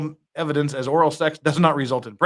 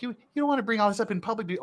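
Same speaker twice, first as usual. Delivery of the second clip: put-on creepy girl voice; 5 semitones higher, 7 dB quieter, faster than usual. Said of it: "not even for the creepy girl".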